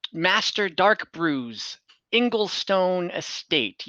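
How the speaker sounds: ordinary delivery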